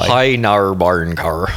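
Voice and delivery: badly copied Northern Irish accent; Northern Irish accent attempt. Shown as a demonstration. Co-host failing to copy the demo, clumsy Northern Irish accent. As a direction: stretch the vowels into bad Northern Irish. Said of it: "I can't do it at all".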